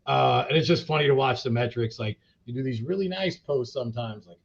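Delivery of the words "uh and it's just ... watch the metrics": usual speaking voice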